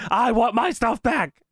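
high, slow mock outrage; indignant demand. Host voicing robbed wrestler, slow indignant demand. Kurt demanding his stuff.

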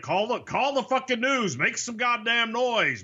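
grumbling that builds to a high angry rant; imitating angry Vince. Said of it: "And he's like".

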